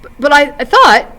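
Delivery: put-on young hobbit stammering at the elf lord. A narrator's reading. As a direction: trip over the first words, caught off guard. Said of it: "he stammered".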